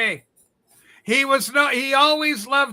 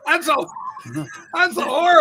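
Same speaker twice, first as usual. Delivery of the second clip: higher, louder, laughing that's horrible; real laughing outcry; louder outraged laugh; 7 semitones higher, 4 dB louder than usual. Real, not acted. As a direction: burst out laughing at the insult. Laughing outburst at Rob's joke.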